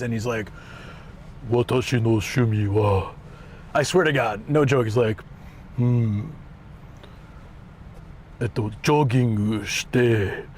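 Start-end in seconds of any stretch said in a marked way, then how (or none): 1.5-3.1 deep, put-on villain Japanese; hammed-up deep anime baddie. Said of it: "trying really hard to be an anime villain". 5.8-10.4 deep, put-on villain Japanese; hammed-up deep anime baddie. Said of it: "it was just super deep".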